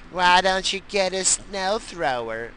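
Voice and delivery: high, slow mimicry of advice; mocking nag. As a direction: parody the question in a high drawl. Mimics the advice once more.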